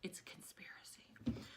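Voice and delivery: whispered mock secret; hushed aside sharing a secret theory; conspiratorial whisper.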